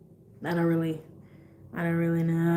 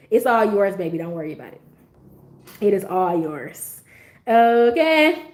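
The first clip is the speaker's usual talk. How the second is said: ordinary talk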